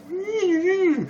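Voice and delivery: very high wordless whine; mock whimper. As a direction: squeak out a fake sad tune. I would like to retract my.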